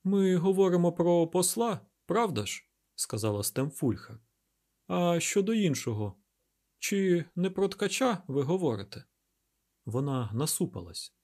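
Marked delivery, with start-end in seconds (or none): 0.0-2.6 high-pitched, louder character voice; pitched-up voice for a woman in dialogue; woman character's voice. A narrator's reading. Narrator voices Stemfulher in dialogue. 4.9-9.0 high-pitched questioning character voice; pitched-up dialogue voice for a woman; woman character's voice. A narrator's reading. Narrator voices Stemfulher in dialogue.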